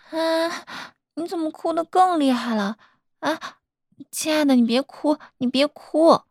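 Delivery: high-pitched, worried fussing; flustered soothing. Acted. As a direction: plead anxiously with a sobbing partner. Partner cries harder, speaker frets.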